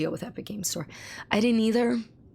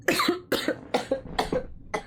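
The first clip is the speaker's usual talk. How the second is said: real choking coughing fit; hacking coughs, caught on spit; 11 semitones higher than usual. Real, not acted. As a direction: cough hard in bursts after inhaling spit. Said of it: "I'm trying not to die".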